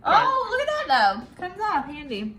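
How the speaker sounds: high, loud wow; thrilled at the almond; delighted. Real, not acted. Almond skin slips off.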